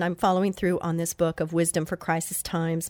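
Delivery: usual speaking voice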